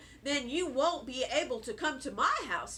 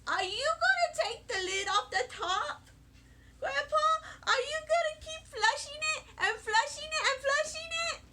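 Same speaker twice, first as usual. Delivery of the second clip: much higher, breathless and giggly; eager questions; 8 semitones higher than usual. Acted. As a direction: bounce with excitement, begging grandpa for details. Child pesters grandpa with questions.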